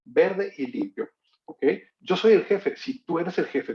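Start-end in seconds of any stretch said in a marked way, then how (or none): 2.0-2.8 put-on proud child. Child proudly claims being boss.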